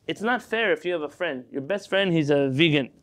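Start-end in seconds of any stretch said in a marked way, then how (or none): none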